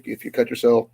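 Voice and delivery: usual speaking voice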